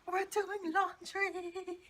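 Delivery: high, loud, slow drawn-out dread; mock dramatic. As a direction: wail it like a tragic fate. Dreading laundry day.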